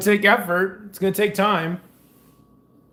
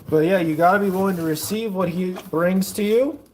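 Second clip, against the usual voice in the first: normal talking voice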